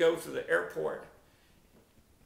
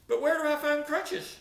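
baffled question; 10 semitones higher, 4 dB louder than usual. Retold or imitated. Retold baffled patient asking doctor.

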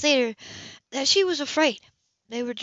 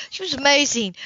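usual speaking voice

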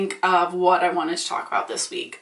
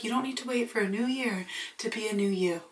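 mock preachy; 9 dB quieter than usual. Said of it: "I can sit here and be like".

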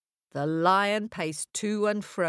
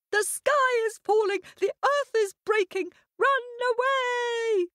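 panicked shout. A narrator's reading. Hare shouting the warning to another hare, high and frantic. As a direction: cry the warning in terror. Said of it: "lift up their heads and cry".

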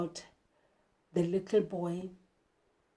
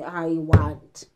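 rude demand; 5 semitones lower, 5 dB louder than usual. Pretend. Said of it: "you don't just come in and say".

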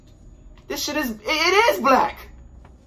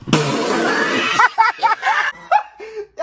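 high, loud falling laugh; shaky relieved laughter; nervous laugh; 10 semitones higher, 4 dB louder than usual. Real, not acted. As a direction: laugh off the fright. Nervous laugh after scare.

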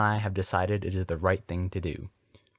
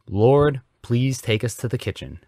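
raised, booming plea, strongest on the first word; bellowed prayer; 5 semitones higher, 6 dB louder than usual. A narrator's reading. Reverend prays aloud before his guests, bellowing. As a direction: cry it out to the heavens. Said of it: "Dumbledore bellowed in a voice even louder".

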